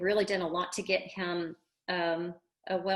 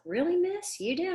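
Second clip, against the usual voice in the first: higher, mock surprised question; surprised student; 10 semitones higher than usual. Coach imitating the student's surprised reply, mock surprised. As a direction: lift it up like a hopeful kid. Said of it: "She goes like".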